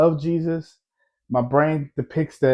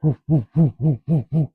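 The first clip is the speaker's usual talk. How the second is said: imitated heartbeat thumps. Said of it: "So my heart was like".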